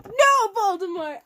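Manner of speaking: high, loud, slow defiant cry; hammed-up shout. A narrator's reading. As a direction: yell back in mock defiance. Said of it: "I shouted back".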